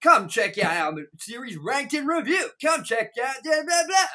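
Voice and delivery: high-pitched mock advert, rising as it goes; hammed-up plug that talks over everyone; blaring promo. Promo screen interrupts the priest at a funeral, blaring pitch. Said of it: "like, too loud the sound".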